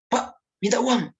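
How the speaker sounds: put-on demanding child, a bit menacing; pressing, demanding tone. Shown as a demonstration. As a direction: make the request sound like a threat. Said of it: "itu menekan ya, seperti mengancam".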